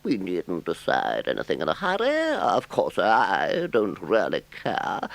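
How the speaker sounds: high, slow, mock-casual Toad; Toad's voice. A narrator's reading. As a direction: pretend not to care while scheming. Toad feigning indifference.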